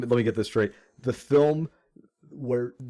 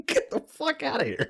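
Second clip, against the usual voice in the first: high squeal through laughter; cracking up at the absurdity; laughing disbelief. Real, not acted.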